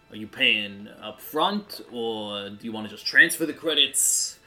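ordinary talk, nothing marked

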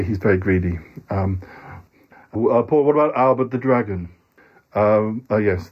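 usual speaking voice

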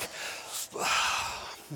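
long unvoiced sigh, moved and overwhelmed. Real, not acted. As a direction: let out a slow breath before the punchline. Overwhelmed by the kind family.

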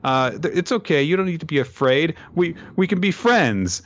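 lightly voiced sweet girl; friendly reassurance. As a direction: coax him like a kind friend. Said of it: "offering a hand of friendship and saying".

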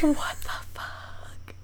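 hushed, breathy whisper. Real, not acted. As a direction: whisper it in disbelief. Whispered reaction to a spooky story.

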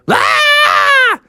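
very high, drawn-out shriek; terrified scream. Acted. Bear family threatened by T-Rexes, shrieking. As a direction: scream in fright.

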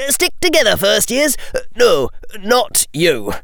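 high, officious prefect's orders. A narrator's reading. Prefect herding first years, high voice. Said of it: "he said in a high voice".

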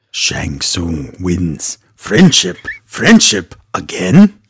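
slow, low announcer voice; game announcer impression. Quoting Mortal Kombat for a co-host, slow announcer impression. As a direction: draw it out like a fighting game's announcer.

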